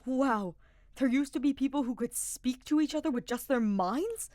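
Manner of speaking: high, loud, eager wonder; bubbling over with curiosity; excited young knight. A narrator's reading. Sylvain's eager voice.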